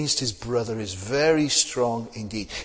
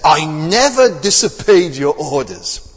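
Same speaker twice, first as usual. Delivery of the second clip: louder, slow and indignant, every word pressed; self-justifying older son; 3 semitones higher, 10 dB louder, slower than usual. Retold or imitated. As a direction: puff up as the son who kept every rule. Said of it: "the way he says it".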